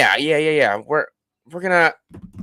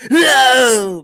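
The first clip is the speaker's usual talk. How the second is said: high, loud mock scream of "No!"; 11 semitones higher, 5 dB louder than usual. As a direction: ham up a horrified scream. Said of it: "just starts screaming".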